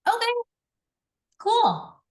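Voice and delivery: high, loud, put-on shrug of a voice; dismissive okay cool. An unimpressed friend answers loudly, high and dismissive. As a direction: brush it off in mock indifference. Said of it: "Or they're gonna be like".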